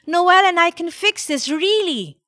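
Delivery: put-on high, urgent little girl; insistent child's high plea; pleading child. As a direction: beg and promise with all your might. Child begging grandmother not to cancel.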